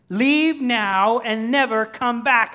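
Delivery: high, slow, shouted command; forceful banishing. Acted. Preacher as Smeagol pressing Gollum to leave, shouting. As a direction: shout the intruder out.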